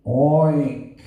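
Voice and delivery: a short put-on oink; mock pig oink. Preacher voicing the pig in his parable, a brief mock oink. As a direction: grunt out a quick pig oink.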